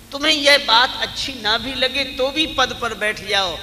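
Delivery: high, loud pleading counsel; emphatic preaching. Acted.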